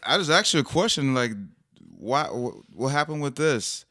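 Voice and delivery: high, loud, fast and agitated; emotional. Retold or imitated. Husband getting upset with his son over a question, high and loud. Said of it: "I'm the one that's kinda emotional".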